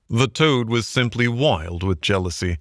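ordinary delivery, nothing marked

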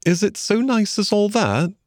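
high-pitched, shy little question; timid, tentative Mole. A narrator's reading. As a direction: ask shyly, voice lifted high. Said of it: "asked the Mole shyly".

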